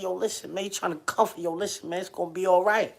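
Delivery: hushed consoling voice; soothing comfort. Retold or imitated.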